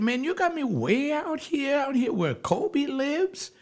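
high-pitched disbelieving marvel; amazed exclamation. Retold or imitated. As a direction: gasp it out like you can't believe where you are. Amazed retelling at Kobe's home.

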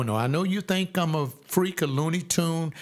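usual speaking voice

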